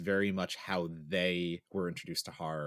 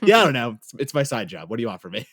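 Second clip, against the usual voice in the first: much higher and louder, exasperated mock defense; my own retort; 4 semitones higher, 11 dB louder than usual. Defending horror hobby to mom, loud mock exasperation. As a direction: throw up your hands, loud and high. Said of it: "And I'm like".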